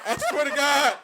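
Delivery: swearing the story is true, laughing hard and loud; laughing insistence. Real, not acted. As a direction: swear it while cracking up.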